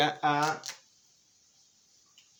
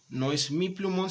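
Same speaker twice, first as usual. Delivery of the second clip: mi pitched higher and punched; stress on mi; 6 semitones higher than usual. Shown as a demonstration. Teacher contrasting mi with mí, stressing mi. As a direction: hit mi to show the possessive. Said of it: "quiero que ese plumón sea para mí".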